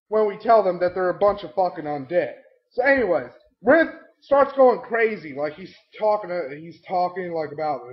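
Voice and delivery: ordinary talk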